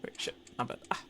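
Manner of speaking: ordinary talk, nothing marked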